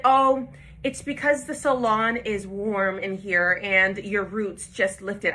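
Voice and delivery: drawn-out, over-patient explaining; slow excuse. Retold or imitated. Manager slowly explaining the roots lifted, drawn out. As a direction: stretch each word like talking down.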